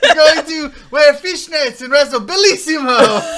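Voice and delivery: loud, high hammy Italian voice; Italian accent. Retold or imitated. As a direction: ham up an Italian wiseguy.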